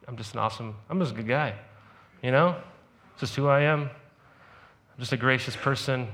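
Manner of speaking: low, soft smug boast; a hint of a self-satisfied braggart; mock self-praise. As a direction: brag about yourself, smugly.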